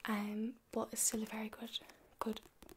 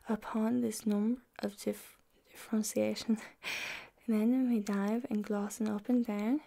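whispered Middle English reading; library whisper; 6 dB louder than usual. Acted. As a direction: read the old verse in a hushed whisper. Reads Chaucer aloud in whisper.

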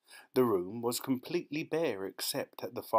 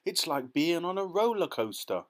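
higher, louder thrilled cry; delighted shout; 7 semitones higher, 4 dB louder than usual. A narrator's reading. Charlie shouting to Grandpa Joe on the lift, thrilled and high. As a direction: shout it like a kid on a ride. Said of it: "Charlie shouted".

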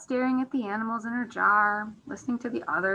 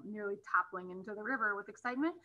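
ordinary delivery, nothing marked

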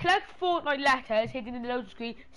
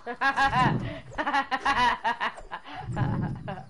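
long rattling burst of laughter; helpless run of ha-ha-ha; laughing. Real, not acted. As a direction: laugh in staccato bursts, trailing away. Laughs at own joke.

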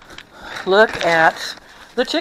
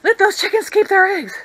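mock vegan rant; 9 semitones higher, 5 dB louder than usual. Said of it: "had a fit about it".

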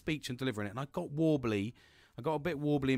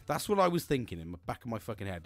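usual speaking voice

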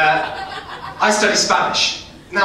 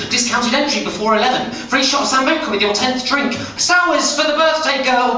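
club promoter hype; 7 semitones higher, 3 dB louder than usual. Pretend. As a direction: pitch climbing higher and louder, promoter patter. Club promoter's sales pitch.

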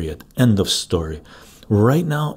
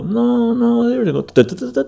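very high, slightly louder sing-song rebuttal; hammed-up eager correction, pitched way up; mock correcting; 5 dB louder than usual. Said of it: "And they go".

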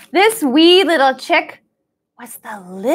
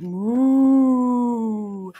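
cow moo. Acted. Cow sound for kids.

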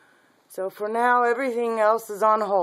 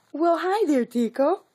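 much higher, bright puppy greeting; 11 semitones higher, 3 dB louder than usual. Real, not acted. Greeting the puppy Tico, in high baby talk.

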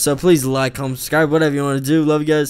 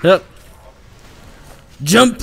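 shouted; 5 semitones higher, 6 dB louder than usual. Real, not acted. Calling out to the team.

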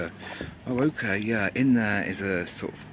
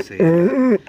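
a much higher, louder mock declaration of seal; loud high repeat; 12 semitones higher, 15 dB louder than usual. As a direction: bellow Seal like an announcement. Repeating word loudly.

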